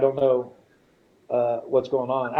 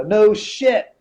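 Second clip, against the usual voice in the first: louder, higher, exasperated comeback; retold sarcastic retort; 6 semitones higher, 5 dB louder than usual. Patient retells answering the doctor, sarcastically exasperated. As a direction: hit it hard as an obvious-fact comeback.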